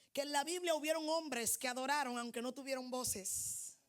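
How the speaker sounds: ordinary delivery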